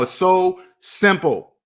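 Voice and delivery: drawn-out "so simple"; slow exasperated stress; slow emphatic. Real, not acted. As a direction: stretch each word out in frustration. Repeating with frustration.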